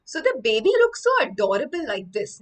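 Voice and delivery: ordinary talk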